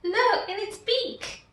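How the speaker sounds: high, slow excited cry; excited call. A narrator's reading. Little girl pointing out the duck's beak, excited. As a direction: urge him to look, pointing. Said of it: "calling and pointing excitedly".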